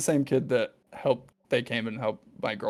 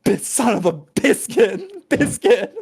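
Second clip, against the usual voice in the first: higher, louder, slow laughing exclamation; mock cursing shout; 12 semitones higher, 9 dB louder, slower than usual. Characters forced to avoid cursing, hammed-up shout. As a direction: swear with biscuit, hammed-up.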